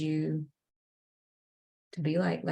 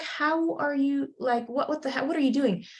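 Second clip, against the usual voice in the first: amazed puppet; 8 semitones higher than usual. Retold or imitated. Clumsy puppet asks his friend in amazement, high disbelieving squeal. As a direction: squeal it out in wide-eyed disbelief. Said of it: "he's like".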